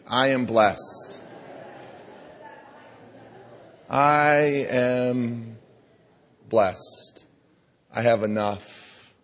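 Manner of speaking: slow, deliberate, meaning every word; said like you mean it. Shown as a demonstration. Congregation leader saying it with conviction. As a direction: lean into "I am blessed" with conviction. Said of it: "say it like you mean it".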